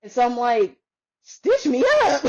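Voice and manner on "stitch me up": high, drawn-out shocked echo; slow high-pitched mock outrage; incredulous. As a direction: re-enact appalled disbelief at the suggestion. Retold disbelief at needing stitches.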